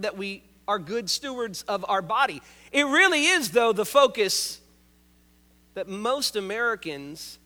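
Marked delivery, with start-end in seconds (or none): none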